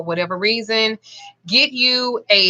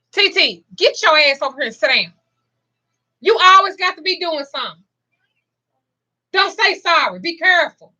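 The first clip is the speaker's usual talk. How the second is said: scolding; 6 semitones higher than usual. Real, not acted. Grandmother scolds clumsy grandbaby to sit down, high scolding. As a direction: scold the grandbaby, stern and raised.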